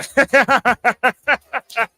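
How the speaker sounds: cracking up helplessly; laughing at a viewer's joke; burst of laughter. Real, not acted. Cracking up at chat.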